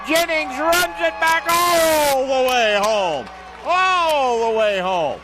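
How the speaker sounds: slow, high, loud stretched call; drawn-out touchdown shout. Real, not acted. Announcer calling the touchdown home, drawn-out shout. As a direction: hold every word as he scores.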